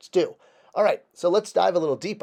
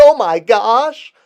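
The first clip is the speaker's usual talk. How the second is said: surprised; 9 semitones higher, 14 dB louder, slower than usual. Real, not acted. Sudden discovery of matching cuff.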